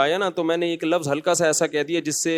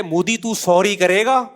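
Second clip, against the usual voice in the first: mock army chief; 4 semitones higher, slower than usual. Imagined army chief demanding Modi say sorry, mocking taunt. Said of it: "army chief کہے".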